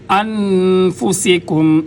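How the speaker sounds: انفسکم with a whistling s, drawn out slowly; slow recited انفسکم, sharp whistling s; whistled sin. Shown as a demonstration. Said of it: "سین سیٹی کی آواز آئے گی".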